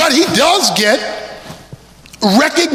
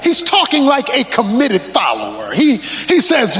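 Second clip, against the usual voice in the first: usual speaking voice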